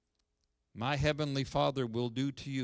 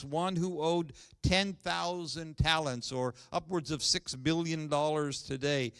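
scripture reading; 3 semitones higher than usual. Acted. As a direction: proclaim the passage to the congregation. Reads scripture passage aloud.

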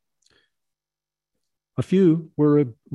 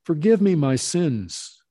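slow, higher, louder plea; begging; 6 semitones higher, 4 dB louder, slower than usual. A narrator's reading. Drunk peasant at the master's feet, begging slow and loud. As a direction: beg him with all you have, slowly. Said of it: "he begged".

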